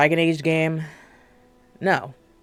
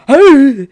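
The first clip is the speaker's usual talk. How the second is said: loud, high, sharp gasp; a big theatrical gasp; shocked gasp; 10 semitones higher, 14 dB louder than usual. Pretend. Mock shock.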